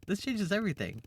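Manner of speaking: high fanatic cry of revelation; zealous exclaim. Acted. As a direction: proclaim it like a convert.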